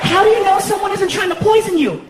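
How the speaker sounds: put-on high-pitched alarmed girlfriend voice. Girlfriend arguing at three a.m., high-pitched warning. Girlfriend argues with him over cakes.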